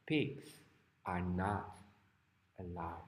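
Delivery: falling, quiet pronouncement with pauses; grave sign reading. Acted. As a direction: read the ban gravely, word by word. Repeats the sign after others enter.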